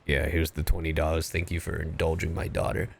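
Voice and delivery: low-voiced, hushed thanks; pretend dad. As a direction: play a dad quietly paying someone off. Pretend dad secretly paying the idol.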